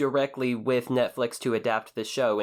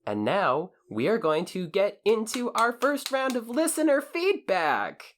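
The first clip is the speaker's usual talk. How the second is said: announcer voice; 8 semitones higher than usual. Acted. Announcing listener feedback segment.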